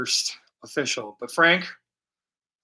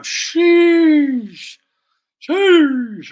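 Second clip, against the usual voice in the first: higher, loud drawn-out exclamation; sheesh; 12 semitones higher, 11 dB louder than usual. Real, not acted. Painter amazed at his light effect, loud high sheesh. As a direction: marvel loudly at the effect.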